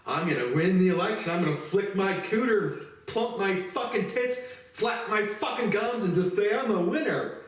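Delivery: high ditzy mock of a candidate; mocking Kari Lake. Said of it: "Kari Lake's response".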